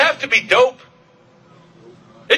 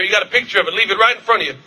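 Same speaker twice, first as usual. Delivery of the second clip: usual speaking voice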